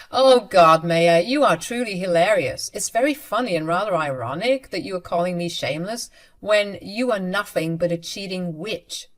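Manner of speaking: usual speaking voice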